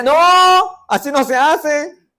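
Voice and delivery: loud, high, drawn-out objection; indignant mock protest. Congregant objecting to new music styles, loud mock indignation. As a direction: cry it out in hammed-up protest.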